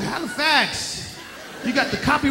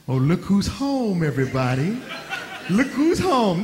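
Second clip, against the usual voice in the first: mocking sing-song greeting; taunting "look who's home"; welcoming someone home; 6 semitones lower than usual. Gangster greeting victim at house.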